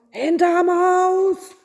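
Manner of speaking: very high, loud, drawn-out roar; exasperated yell. A narrator's reading. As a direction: cut them off with a long, slow yell. Innkeeper cuts off the kings.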